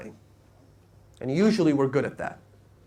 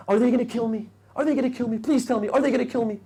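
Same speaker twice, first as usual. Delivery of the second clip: terrified pleading; 7 semitones higher, much faster than usual. Retold or imitated. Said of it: "he started crying".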